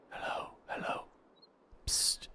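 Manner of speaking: whispered hello; soft unvoiced call for attention; psst for attention.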